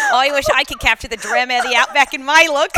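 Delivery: broad put-on Australian ad read; Australian accent. Shown as a demonstration. Co-host reprising a CoverGirl commercial, Australian accent. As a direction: repeat the slogan in a mock Australian accent. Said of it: "do my Australian accent".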